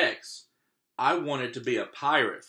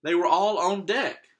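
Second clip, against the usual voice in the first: usual speaking voice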